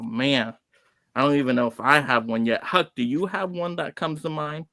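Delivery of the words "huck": usual speaking voice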